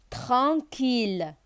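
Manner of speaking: Tranquille drawn out slowly for the class; Tranquille, each syllable held; slow syllable demo. Shown as a demonstration. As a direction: model Tranquille slowly so pupils can copy. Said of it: "teacher repeats the model word".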